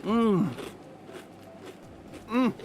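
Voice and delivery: chewing ice cream; munching into the mic; mouth full. Real, not acted. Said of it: "Sorry to be munching".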